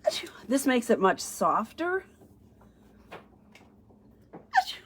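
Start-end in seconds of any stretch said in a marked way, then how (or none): 0.0-0.3 real sudden sneeze; short, genuine sneeze. Real, not acted. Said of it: "I'm gonna sneeze again". 4.3-4.8 high, sudden sneeze; real high-pitched achoo. Real, not acted. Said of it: "can't stop sneezing today".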